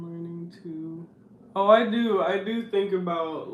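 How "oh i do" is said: ordinary talk, nothing marked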